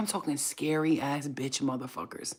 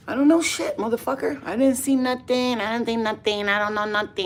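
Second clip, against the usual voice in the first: loud, high stonewalling; defiant yell; 5 semitones higher, 6 dB louder than usual. Pretend. To the questioning cops, a loud high refusal. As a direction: shout them down, refusing everything.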